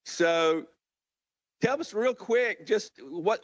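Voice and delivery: usual speaking voice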